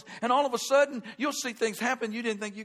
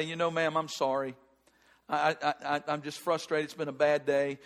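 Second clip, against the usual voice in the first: softened apology; 6 semitones lower than usual. Retold or imitated. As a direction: let the fight drain out and apologize gently. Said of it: "I'm softening up".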